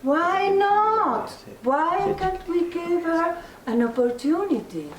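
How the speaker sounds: put-on high, eager child voice; children's voice. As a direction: plead like curious kids. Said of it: "the children came along and said".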